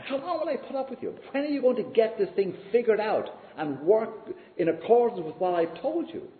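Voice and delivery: high, fast, exasperated rebuke; exasperated anger. Retold or imitated. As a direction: scold the disciples, fed up. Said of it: "the anger in our Lord's voice there".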